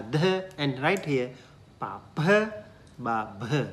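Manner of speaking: kha, gha, pha, bha with a breathy puff after each; aspirated pairs. Shown as a demonstration. Teacher running through the Pali aspirated consonants. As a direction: run the aspirated row. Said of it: "All those letters with the H sound".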